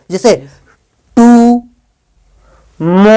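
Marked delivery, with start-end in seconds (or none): 1.2-3.1 "टू", long pause, then a stretched "मो"; "टू ... मो" sounded out with a full stop between; pausing between syllables. Shown as a demonstration. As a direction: hold "टू" and "मो" as separate syllables. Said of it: "तोड़ रहे हो, रुक रहे हो".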